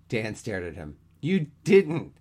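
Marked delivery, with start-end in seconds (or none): 1.2-2.1 loud, high, scandalized exclamation; stunned disbelief. A narrator's reading. Dan staring at his friend after a near confession, stunned. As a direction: hit it high and hard, aghast.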